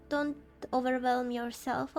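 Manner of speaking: usual speaking voice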